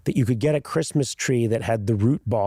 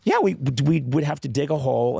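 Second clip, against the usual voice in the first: normal talking voice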